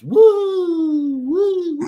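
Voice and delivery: high, loud sarcastic woo-hoo; hammed-up fan celebration, mocking them; mock cheering. Mocking Florida State fans celebrating a cheap win, high mock cheer.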